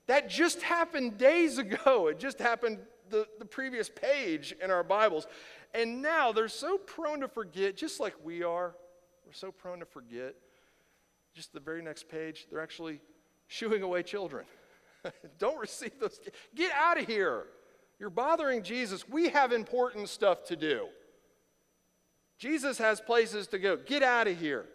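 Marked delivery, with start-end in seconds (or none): 0.1-8.8 higher and louder, driving the point home; preaching at full force. Acted. Pastor pressing his congregation, loud and higher. 15.4-24.7 higher, louder mock shoo; shooing kids away. Disciples shooing children away from Jesus, loud and higher.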